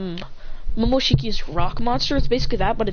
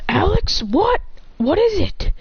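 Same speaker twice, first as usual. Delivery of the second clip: high-pitched, pressing questions; acted anxious urgency; urgent questioning; 9 semitones higher, slower than usual. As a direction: demand an answer in alarm. Troy anxiously asks Alex for result.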